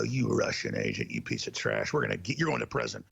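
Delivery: low, rough mock accusation; mimicked hecklers. Hecklers accosting host on the street, mock-accusing. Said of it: "walks up to me in the streets".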